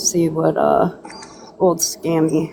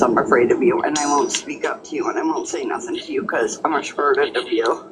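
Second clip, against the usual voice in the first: lower-pitched, dismissive imitation; mocking quote; 5 semitones lower than usual. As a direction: quote the accusation back with mild mockery. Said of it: "good old Snarky Doodle says".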